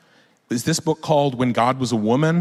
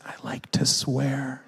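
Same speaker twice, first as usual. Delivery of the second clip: soft, slow, mock-sad child; tearful confession; 8 dB quieter, slower than usual. Four-year-old crying to a parent, tearful confession. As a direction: confess it quietly, drawn out, as if crying. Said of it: "he cries, and he goes".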